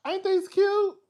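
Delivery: high squeal over shoes; a high, delighted coo; gushing over shoes. Real, not acted. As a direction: squeal ain't these cute. Showing off shoes.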